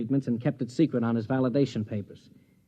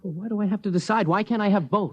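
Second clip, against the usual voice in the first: higher, exasperated question; indignant protest; 6 semitones higher than usual. Acted. Husband protests his wife's ultimatum, indignant and exasperated. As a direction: push back against her demand.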